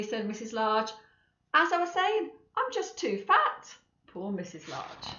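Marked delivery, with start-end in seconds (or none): 1.5-3.7 mummy voice. Acted. Mother voiced, high.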